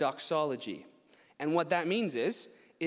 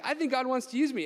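put-on eager kid voice; 9 semitones higher, 5 dB louder, faster than usual. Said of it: "he's like".

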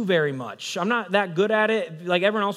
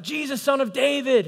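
high, raised call for attention; acted crying out; 9 semitones higher, 3 dB louder than usual. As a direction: call out like a beggar pleading to be heard. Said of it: "and he cries out".

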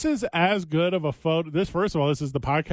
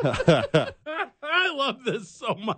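laughing; 9 semitones higher, much slower than usual. Real, not acted. Delighted by the ticket photo.

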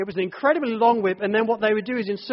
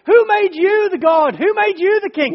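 much higher, louder furious scorn; furious contempt; 10 semitones higher, 7 dB louder than usual. Retold or imitated. Someone raging at God, furious contempt. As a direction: scorn God at full pitch.

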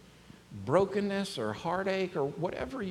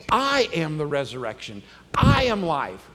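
loud, emphatic declaration; bold, ringing claim; 7 dB louder than usual. Retold or imitated. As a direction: proclaim it with a big punch on I. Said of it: "This is bold".

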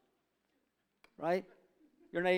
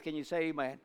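ordinary delivery

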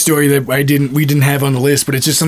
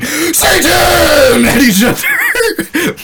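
high, loud yell breaking into laughter; shout; 14 semitones higher, 4 dB louder, much slower than usual. Retold or imitated. Two boys yelling Satan at each other, loud and laughing. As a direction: roar it at your friend. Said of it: "getting louder and louder".

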